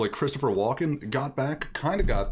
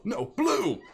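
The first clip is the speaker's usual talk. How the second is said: high squeaky cry of panic; a strangled last-second correction; mock panicked squeak; 8 semitones higher than usual. Quoting the bridge scene, voice cracking high. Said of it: "Monty Python bridge quote".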